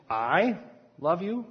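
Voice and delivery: "you" lifted in a rising question; questioning I love you. Shown as a demonstration. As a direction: ask "I love you?" in disbelief. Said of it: "here the question is".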